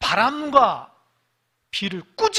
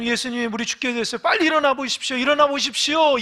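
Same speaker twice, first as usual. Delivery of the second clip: higher, louder frantic plea; frightened disciples shouting for Jesus; panicked disciples; 7 semitones higher, 9 dB louder than usual. Retold or imitated. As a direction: beg him to wake up, urgently. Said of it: "예수님께".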